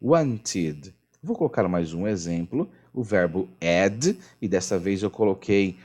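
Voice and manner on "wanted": "wanted" with a light "tid" ending; teacher giving the model "wanted" in passing. Shown as a demonstration. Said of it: "a pronúncia é wanted".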